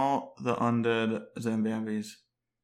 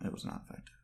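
whisper; 14 dB quieter, much faster than usual. Real, not acted. Grumbled aside after failed spell.

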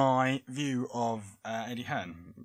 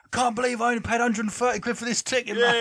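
fast, louder, higher boozy fan; boxing fan voice; 9 semitones higher, 6 dB louder, faster than usual. Pretend. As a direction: rant like a tipsy fan in the cheap seats. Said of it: "That's my boxing fan voice, mate".

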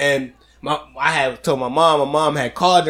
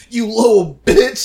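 higher, slow, seething insult; mock angry mom; 7 semitones higher, 4 dB louder, slower than usual. Mom confronting the clerk, mock furious insult. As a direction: spit it out like an enraged mother. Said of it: "was like".

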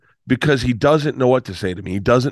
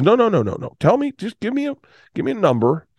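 much higher, pleading insistence; insistent dad; 11 semitones higher than usual. Retold or imitated. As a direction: press hard like the dad who must pay.

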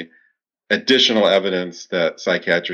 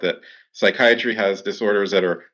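ordinary talk

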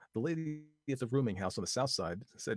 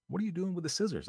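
alarmed; 10 semitones higher, 4 dB louder than usual. Acted. Vi alarmed question.

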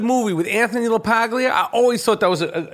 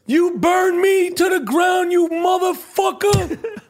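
high, slow, shouted movie rage; Danny Aiello quote; 11 semitones higher, slower than usual. Retold or imitated. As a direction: yell like Sal watching his pizzeria burn. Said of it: "how good Danny Aiello was in Do the Right Thing".

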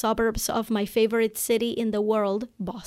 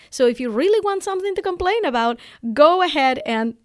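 higher and louder, faster; rising excitement; 6 semitones higher, 6 dB louder than usual. Real, not acted. Explaining the family saying to viewers, amped up. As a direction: build it up with playful energy.

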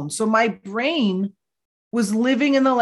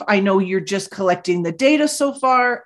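usual speaking voice

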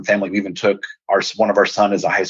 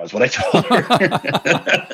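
slow, higher, laughing through the words; amused, laughing aside; 8 semitones higher, much slower than usual. Real, not acted. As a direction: break into a laugh at your own confession. Laughs at his own confession.

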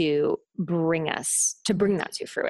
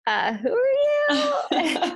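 high, drawn-out mock bafflement; baffled; 3 dB louder, much slower than usual. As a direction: squeal it slowly, playing up the confusion. Acting out her startled reply.

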